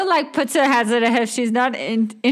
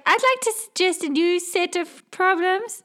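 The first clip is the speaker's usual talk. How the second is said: higher, cheeky voice; sassy Oriel; 8 semitones higher than usual. Acted. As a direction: pipe up with mock politeness. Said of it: "She's like".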